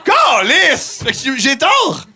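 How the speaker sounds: hammed-up indignant yelling; mock outrage. Mock outrage, friends laughing.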